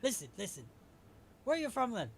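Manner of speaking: loud, high demanding question; acted testy interrogation; impatient sailor. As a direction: cut in and demand an answer.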